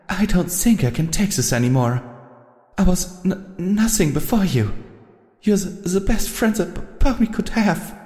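high, stammering tearful voice. A narrator's reading. Rarity cries alone in the restroom, stammering. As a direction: break down on the words.

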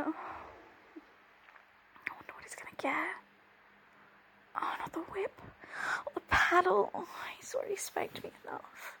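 soft, fast unvoiced worrying; anxious whisper to herself. Whispering to herself while waiting anxiously. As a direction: murmur your fears under your breath.

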